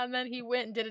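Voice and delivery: usual speaking voice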